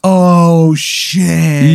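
high, drawn-out hype from onlookers; crowd reaction. Acted. Everyone on your team reacts, mock awe. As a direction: play the teammates bowled over by a burn. Voices the whole team's reaction.

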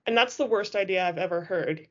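plain reading voice

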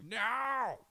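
high, loud cry of no; a sudden high shout of dismay; mock yell. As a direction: yell it out in protest. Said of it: "And I'm like".

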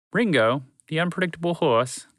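ordinary delivery

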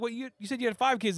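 high-pitched, incredulous challenge; retold incredulity. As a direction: question it like you caught a lie. Acted challenge to Benny's changed story.

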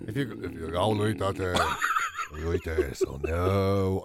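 very low demo voice with a laugh; forced deep register; going deep. Shown as a demonstration. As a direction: drop the voice right down into the chest. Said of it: "number one is go deep".

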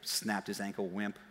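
understated mock scorn; mock aside. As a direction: mutter a teasing insult. Quiet mocking aside.

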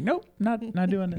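put-on squeaky child digging in their heels; stubborn toddler. Toddler refusing the potty, high stubborn kid voice.

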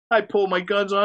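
ordinary talk, nothing marked

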